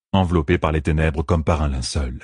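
ordinary talk

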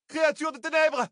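very high alarmed shout; sudden cry. Acted. A woman crying out at a monster, high shout. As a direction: raise the alarm. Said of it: "cria soudain une femme".

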